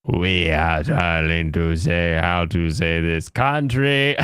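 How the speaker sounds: slow, low mock instructional voice; mimicking video narrator. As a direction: drag it out like an odd tutorial narrator. Mimicking the pronunciation video just played.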